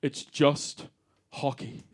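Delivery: low, slow Canadian correction; Canadian accent. Acted. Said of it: "They're always like".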